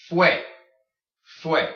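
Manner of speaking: fue as one crisp fway. Shown as a demonstration. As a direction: say Fue emphatically with a way sound. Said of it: "it makes the way sound".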